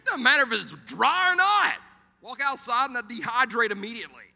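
loud, high comic protest; hammed-up hollered complaint; exasperated rant. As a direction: shout it down in mock outrage. Exasperated retort about the heat.

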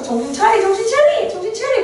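rising then high urging; dog urging. Retold or imitated. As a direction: build from talk into a high nag. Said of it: "그랬어".

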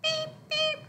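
very high squeaky beeps; playful beeps. Acted. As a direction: boop the nose with tiny high beeps. Booping baby's nose playfully.

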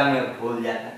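dropped echo of the gossip; quiet teasing repeat; quiet mocking echo. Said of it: "वो तो ये जमाना बोलता है".

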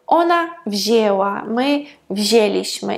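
wzięła and wzięliśmy slowly, ę as plain E; nasal not heard. Shown as a demonstration. Teacher showing past forms, wzięła and wzięliśmy slowly without nasality. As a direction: draw out wzięła, wzięliśmy with no N sound. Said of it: "вже там не чуємо цієї голосної".

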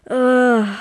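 loud, drawn-out ugh; disgusted groan. Real, not acted. Player fed up with lewd messages, loud disgusted groan.

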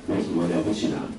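usual speaking voice

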